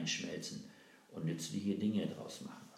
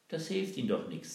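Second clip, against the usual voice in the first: confident retort; 7 semitones higher, 6 dB louder than usual. Acted. Girl answers Melchior.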